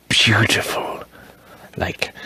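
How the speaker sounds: awed whisper; hushed in awe. Real, not acted. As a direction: breathe the word out in wonder. Awed at the ink pattern.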